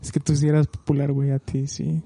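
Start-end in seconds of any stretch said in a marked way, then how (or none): none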